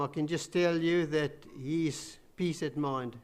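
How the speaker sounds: usual speaking voice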